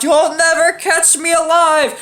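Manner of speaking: high, slow cartoon taunt; mock outlaw taunt. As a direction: imitate a fugitive's cocky cry. Retold taunt while fleeing.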